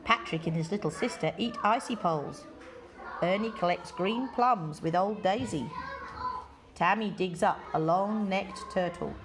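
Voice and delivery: usual speaking voice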